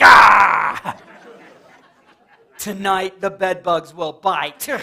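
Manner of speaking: loud shout then slow spooky threat; mock scare shout. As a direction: leap out and roar, then drawl the threat. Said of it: "I'd be like".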